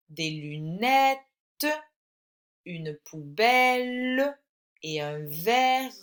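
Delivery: slow, with drawn-out final syllables; slow dictation. Shown as a demonstration. Teacher helps pupil spell the words, slow dictation. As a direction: stretch the end of each word for spelling.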